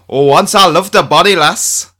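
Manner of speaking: a nervous, giggly attempt at a Scottish accent; half-laughing try at a Scottish lilt. Ed attempts a Scottish accent while telling Julia a fact, nervously laughing. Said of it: "I love your nervous laughter every time you attempt a Scottish accent".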